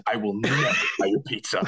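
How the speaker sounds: deadpan vow breaking into laughter; retold indignation dissolving into laughs; laughing mock vow. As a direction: declare it, then crack up.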